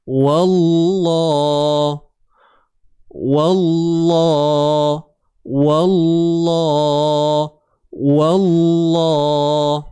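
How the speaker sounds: Wallah with a thick lam, light waw; heavy lam. Shown as a demonstration. Teacher drills Wallah with heavy lam. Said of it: "Tebalnya pada lam".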